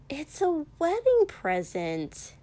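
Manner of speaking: pointed comeback, a bit slow; countering. Acted. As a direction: push back, sure of yourself. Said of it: "Mina countered".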